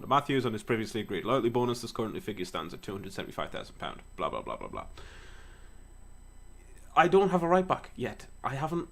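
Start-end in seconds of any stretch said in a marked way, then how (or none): 4.2-4.9 much lower, bored mumble; dismissive blah. Real, not acted. Skipping the boring transfer text, dismissive. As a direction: brush off the rest.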